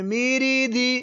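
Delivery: high-pitched demonstration. Shown as a demonstration. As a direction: pitch it up for the pupil to copy. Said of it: "ऊंची आवाज में".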